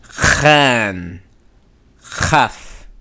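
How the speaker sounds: Khan and Chaf with a long breathy kh; long fricative. Shown as a demonstration. Teacher contrasting fricative with plosive. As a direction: stretch the kh sound out. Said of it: "you sound it out longer".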